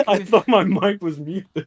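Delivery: laughing through the words; embarrassed giggly explanation. Real, not acted. Embarrassed after barking into mic.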